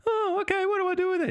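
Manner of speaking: very high little hobbit voice; shrill hobbit. Acted. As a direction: naive squeaky hobbit taking the bait. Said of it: "in your shrill little hobbit voice".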